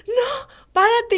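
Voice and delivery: imitating mother shouting stop; mock mom order. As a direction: order the child to stop, half laughing. Mom voiced ordering her to stop.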